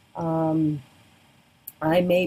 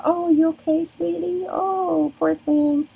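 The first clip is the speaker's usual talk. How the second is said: high, sing-song comforting coo; cooing to a hurt little girl; 10 semitones higher, 4 dB louder than usual. Retold or imitated. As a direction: soothe a crying toddler, sweetie-style. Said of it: "will go".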